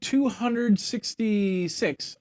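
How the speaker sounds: booming high ringmaster welcome. Acted. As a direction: roll up the crowd like a circus barker. Grand show opening announcement.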